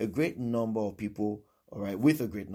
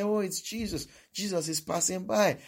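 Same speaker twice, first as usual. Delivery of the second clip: excited announcement; 9 semitones higher, 3 dB louder than usual. Acted. Acting bystanders announcing Jesus passing.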